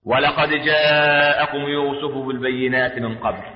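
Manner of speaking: low, very slow melodic chant; Quranic recitation. Acted. Preacher reciting the verse on Joseph, slow low chant. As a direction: chant it low with long held vowels.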